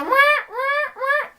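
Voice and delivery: high, honking alarm wail; imitated alarm. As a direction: mimic a blaring buzzer, wah-wah. Imitates the alarm sound she heard.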